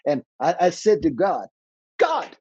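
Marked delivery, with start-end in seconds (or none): 2.0-2.3 high-pitched mock protest to God; squeaky, retold disbelief; incredulous protest. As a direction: plead the objection in a much higher voice.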